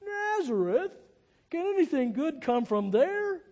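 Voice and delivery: high, loud incredulous question; Nathanael. Retold or imitated. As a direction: act out a scoffing skeptic's disbelief. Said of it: "Nathanael asked".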